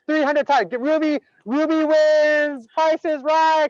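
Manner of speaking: high, loud, drawn-out announcer cheer; big triumphant shout of a host; game-show winner announcement. Pretend. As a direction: crow it like a game-show host, stretching the last words.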